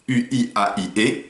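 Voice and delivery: U I A I E égrenés lentement, voyelle par voyelle; détacher chaque son U I A I E; voyelles détachées. Shown as a demonstration. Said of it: "c'est du cinq syllabes". (épeler les voyelles U I A I E lentement)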